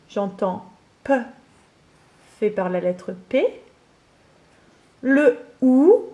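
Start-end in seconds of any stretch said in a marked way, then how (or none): 5.0-6.1 the sound "ou" drawn out and stressed; sound isolated. Shown as a demonstration. Teacher sounding out "ou" for a pupil. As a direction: isolate the vowel "ou" clearly. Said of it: "je vous rappelle que le ou".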